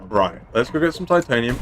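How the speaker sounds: usual speaking voice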